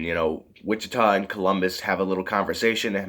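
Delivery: normal talking voice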